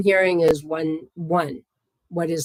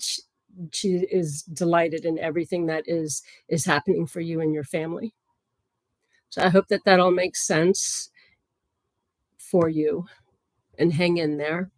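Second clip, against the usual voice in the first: usual speaking voice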